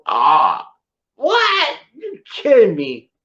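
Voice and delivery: retold shock with the pitch jumping up on what; acting out stunned disbelief; hearing James Earl Jones is coming, a replayed gasp of surprise; mock disbelief. Retold disbelief at Carrie's call.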